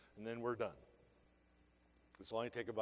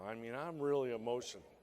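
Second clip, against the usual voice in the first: usual speaking voice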